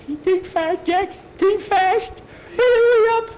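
very high, squeaky urgency rising to a yell; panicked urging. Acted. Skeeter panics while Jackie thinks.

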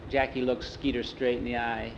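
ordinary delivery

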